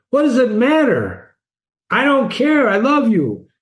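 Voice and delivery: high, pleading lover's cry; captain begging his love, pitched high. Retold or imitated. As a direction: beg her, pitch soaring. Captain pleading, kid crying.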